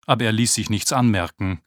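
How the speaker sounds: ordinary delivery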